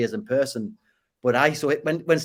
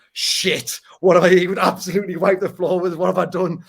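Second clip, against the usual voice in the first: higher, louder burst of dread at what he had done; panicked inner thought; 5 semitones higher, 6 dB louder than usual. Retold or imitated. A man recalling his panic after squaring up to a boxer, louder and higher. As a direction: blurt it out like a sudden sinking realisation. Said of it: "I remember going to the toilet and just sitting there thinking".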